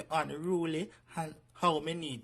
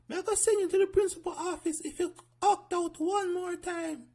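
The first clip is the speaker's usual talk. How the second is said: prim high schoolteacher, strict; high bossy teacher; 13 semitones higher, 6 dB louder than usual. Acted. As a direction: lift it high and stern like a teacher.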